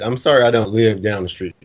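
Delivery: ordinary talk, nothing marked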